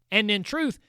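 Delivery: usual speaking voice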